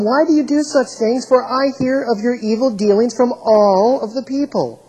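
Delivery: loud, high-pitched scolding read from scripture; ringing indignant reproach; stern rebuke. Acted. Eli rebukes his sons.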